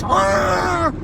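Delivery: imitated road-rage roar, pitched high; mock angry "aah"; angry yell. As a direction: mimic an enraged driver yelling. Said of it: "the anger".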